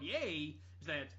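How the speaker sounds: ordinary talk, nothing marked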